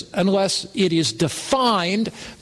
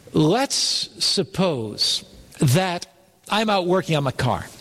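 ordinary delivery

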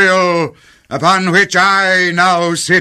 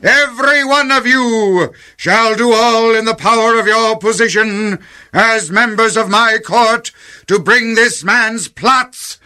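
raised-pitch royal decree; imperious command; 4 semitones higher than usual. Acted. King orders his court to stop a plotter, commanding. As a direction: order the whole court with weighty authority.

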